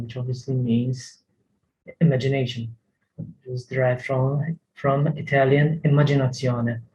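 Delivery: normal talking voice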